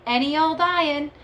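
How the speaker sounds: high, loud scrap-man call; street cry. A narrator's reading. Reading to children, voicing the scrap metal man's street call. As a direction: call out like a rag-and-bone man.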